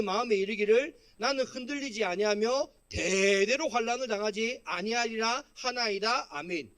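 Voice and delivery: slow, loud, measured Bible reading; scripture reading. Acted. Pastor leading the congregation in reading the psalm, scripture reading. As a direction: read the verse out firmly for all to follow.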